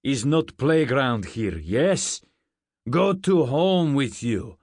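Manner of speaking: put-on loud Russian-accented guard; thick Russian accent. A narrator's reading. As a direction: order them off in a heavy Russian accent. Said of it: "He had a thick Russian accent".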